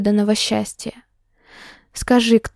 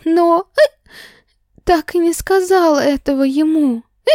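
высоко, сбивчиво, икая; плача, с икотой; сквозь икоту; 8 semitones higher than usual. A narrator's reading. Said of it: "добавилась ещё и икота". (с икотой и слезами)

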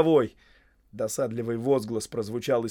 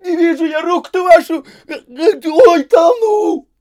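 very high, loud wailing scream; frantic, tearful shriek; drowning panic; 16 dB louder, slower than usual. A narrator's reading. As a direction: cry out in terror as you go under.

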